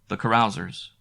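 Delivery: plain reading voice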